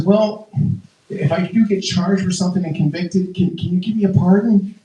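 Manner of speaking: high, halting plea; Tiger Woods. Acted. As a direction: ask sheepishly for a favor in a lightly acted voice. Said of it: "I think the conversation went something like this".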